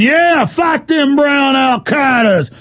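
slow, high mock war whoop; gung-ho supporter. Host imitating listeners who went along, jeering shout. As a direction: holler it like a hammed-up flag-waver. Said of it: "you were going along with it".

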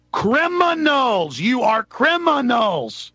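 high, slow, drawn-out accusation; accusing cry. Real, not acted. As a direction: stretch each word out like an indictment.

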